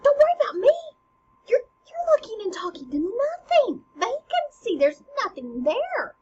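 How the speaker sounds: high, loud acted protest; frantic mother insisting to her son; alarmed queen. Queen sees son talking to nothing.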